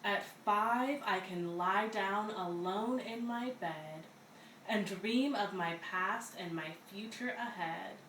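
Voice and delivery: plain reading voice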